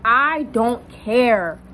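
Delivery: very slow, stretched-out dismissal; emphatic indifference. Retold or imitated. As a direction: drag out each word to make the point. Insisting she is indifferent.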